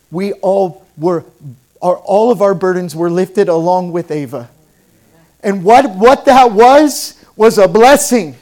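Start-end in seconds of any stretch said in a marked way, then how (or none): 5.4-8.4 loud, slow, high sermon build; preaching at full force. Real, not acted. Pastor preaching to his church, loud and fervent. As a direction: preach it out to the congregation.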